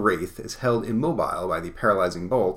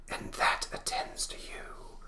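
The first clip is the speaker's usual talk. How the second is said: slow spectral whisper; hushed, drawn-out ghost voice; ghostly whisper; 9 dB quieter, slower than usual. Acted. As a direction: whisper it slowly as a victorious ghost. Spectre banishes the locusts.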